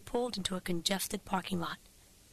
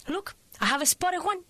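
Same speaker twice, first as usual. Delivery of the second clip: pitched up high with eager delight; excited pointing; 9 semitones higher than usual. Acted. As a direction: point it out all thrilled. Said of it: "She was pointing excitedly".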